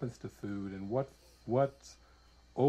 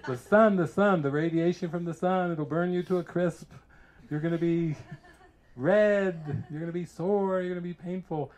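mock alarm; 5 semitones higher, 4 dB louder than usual. Voicing the alarmed sun mindset.

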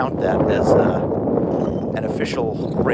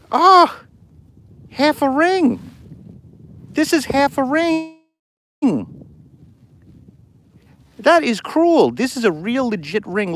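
very high, wailing protest; high-pitched, heartbroken whine over a broken find; dismayed disbelief; 12 semitones higher, slower than usual. Real, not acted. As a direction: squeal in exasperated disbelief at a cruel letdown. Dismay at finding a broken ring.

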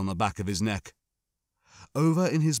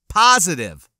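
firm insistence; 6 semitones higher, 9 dB louder than usual. A narrator's reading. Boy insists firmly.

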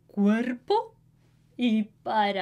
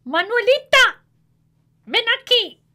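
high, louder calling mother; 11 semitones higher, 7 dB louder than usual. A narrator's reading. Mother calling Manuelita over, high loud call. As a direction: call the children like a busy mom. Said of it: "Y mamá dice".

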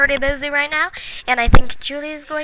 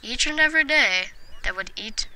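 mock storybook; 5 dB quieter than usual. Inventing the Sims bedtime story, soft mock storybook telling. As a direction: read it like a fairy tale to a child.

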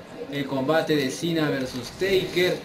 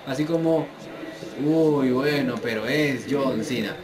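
ordinary delivery

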